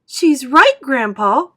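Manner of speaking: high, loud call to grandpa; excited cry. A narrator's reading. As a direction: cry out in agreement. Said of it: "cried Charlie".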